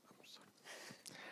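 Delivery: soft whispered aside, barely voiced; real whisper, apologetic; whispered apology. Real, not acted. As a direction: slip in a hushed sorry so as not to interrupt. Interrupts while the other talks.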